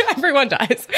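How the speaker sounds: repeating it through laughter; cracking up on the words; laughing. Real, not acted. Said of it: "I can't say that without laughing".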